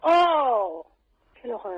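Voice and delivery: normal talking voice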